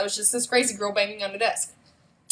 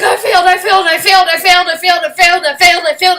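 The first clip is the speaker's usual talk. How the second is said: high, loud, frantic repeated cry; mock tantrum wail of a preschooler; retold preschooler screaming; 10 semitones higher, 15 dB louder than usual. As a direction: scream it like a panicking little kid. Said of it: "I was like".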